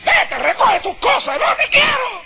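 high, slow, mock-furious dismissal; angry wife. Wife in anger telling husband to pack and leave, high mock fury. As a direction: throw him out of the house, pitch up.

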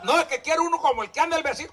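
fast, high mocking whine; mock envious believer. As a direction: rattle it off like an envious neighbour.

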